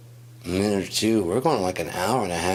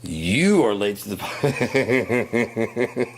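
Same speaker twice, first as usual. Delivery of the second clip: slow, louder, higher voice breaking into laughter; laughing; 4 semitones higher, 5 dB louder, much slower than usual. Acted. Mocking the hydrant fans, laughing through the words. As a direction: lose it to laughter while mocking.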